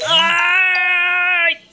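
loud yelp as the thorn comes out; sharp pain yell. Acted. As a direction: yelp like something just ripped free. Pulling out a thorn.

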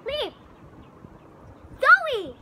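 loud scolding call; calling the dog. Real, not acted.

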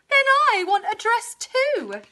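high begging cow wail; bawling cow. A narrator's reading. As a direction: whine it like a jealous cow. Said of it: "bawled Cow".

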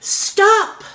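loud, high single-word halt; commanding shout. A narrator's reading. Chief frog halting the fighting animals, shouting. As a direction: shout to break up a squabble. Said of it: "the chief frog shouted".

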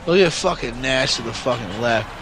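ordinary talk

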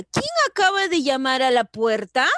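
loud question with a rising finish on "puerta"; questioning tune. Shown as a demonstration. Teacher reading a question example to pupils, questioning tune. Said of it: "Es una pregunta".

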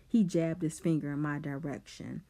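ordinary delivery

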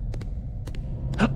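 quiet sharp intake of breath; real startled gasp. Real, not acted. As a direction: gasp in surprise. Gasp on seeing something in game.

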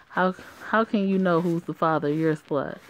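ordinary delivery

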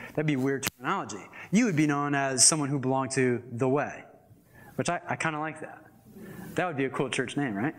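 usual speaking voice

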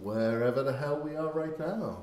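ordinary delivery

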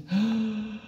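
soft high gasp of surprise; small gasp. Acted. Alma finding a beast up the tree, a soft gasp. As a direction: catch your breath at what you find.

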